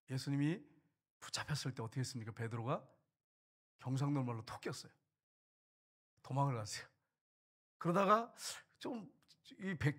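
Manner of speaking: ordinary talk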